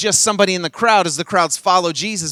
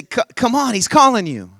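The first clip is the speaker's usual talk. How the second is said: calling out; 6 semitones higher than usual. Acted. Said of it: "They said, say".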